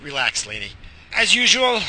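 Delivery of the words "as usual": mock scolding. Teasing wife loudly.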